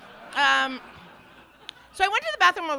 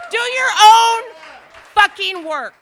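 emphatic; 10 semitones higher, 14 dB louder than usual. Acted. Emphatic advice.

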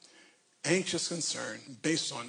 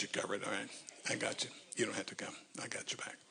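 soft hushed aside; whispering; 4 semitones lower, 10 dB quieter, faster than usual. Real, not acted. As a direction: whisper it to her privately. Teasing aside to Pastor Barbara.

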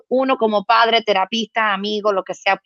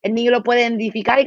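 usual speaking voice

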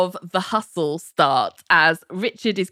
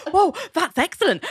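mock pleased surprise; 11 semitones higher than usual. [name] rallies after being taken aback.

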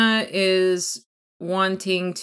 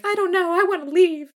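whiny plea; 12 semitones higher, faster than usual. Retold or imitated. Tresselaine pleading to leave.

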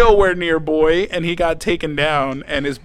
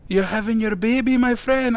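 higher-pitched imitation of a Puerto Rican doctor; comic Puerto Rican accent; 5 semitones higher than usual. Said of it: "The doctor's accent was hilarious".

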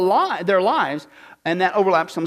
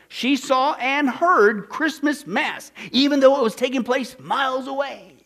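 emphatic, raised storytelling read; declaimed reading; 6 semitones higher, 5 dB louder, slower than usual. Acted. Preacher reading the television patron's story to congregation, declaimed. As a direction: punch each phrase for incredulous effect.

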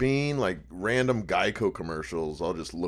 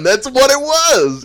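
excited realization; 13 dB louder, slower than usual. Real, not acted. Recognizes the pig ad.

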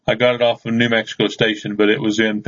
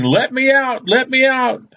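high-pitched imitation of a screaming cry for help; retold scream, pitched way up without much volume; mock scream; 13 semitones higher than usual. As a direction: squawk it out high, like calling to be freed. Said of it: "for a woman screaming".

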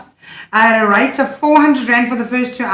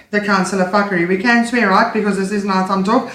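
usual speaking voice

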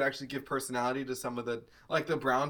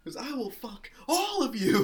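shrieking villain; 10 semitones higher than usual. Retold or imitated. Acting out Cruella.

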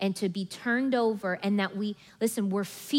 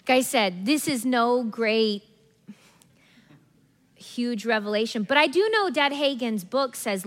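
ordinary talk, nothing marked

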